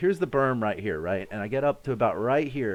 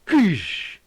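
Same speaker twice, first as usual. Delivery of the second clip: loud, high mouth-made blast; imitated grenade pop; explosion noise; 8 semitones higher, 6 dB louder than usual. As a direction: spit out a blast sound.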